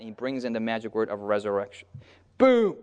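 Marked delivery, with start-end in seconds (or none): 2.4-2.8 shouted high and loud; sudden high shout; exclaiming boom. Real, not acted. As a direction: slam it down like a punchline. Preacher exclaiming the turning point.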